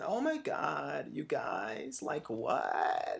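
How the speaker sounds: creaky, drawn-out vocal fry voice. Shown as a demonstration. Teaching a student about vocal fry, in creaky fry. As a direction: drag it out in lazy fry like a reality star. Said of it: "they're talking like this".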